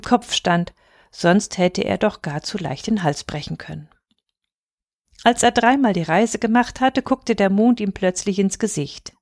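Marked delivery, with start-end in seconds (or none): none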